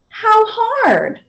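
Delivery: a lightly voiced child; little girl asking. A narrator's reading. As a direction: ask it like a worried child. Child asks.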